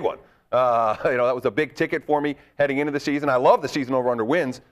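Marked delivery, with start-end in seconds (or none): none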